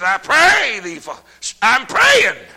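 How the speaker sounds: high, loud, slow chanted plea; preacher crying the rich man's request to Father Abraham; preaching at full force. Acted. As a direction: ring out the verse like a desperate prayer. Voicing the rich man in hell.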